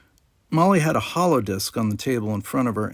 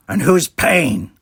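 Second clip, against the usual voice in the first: put-on louder, knowing drawl of an older alien; smug Ferengi barkeeper; 6 semitones higher, 8 dB louder, slower than usual. A narrator's reading. Quark greets his nephew and Jake, a louder smug Ferengi voice. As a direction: tease the boys with a grin.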